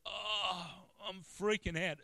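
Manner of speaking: high, loud, drawn-out retold panic; overwhelmed, pitch shooting up; freaked out. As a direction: panic aloud, stretching the words. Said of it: "and I freaked out".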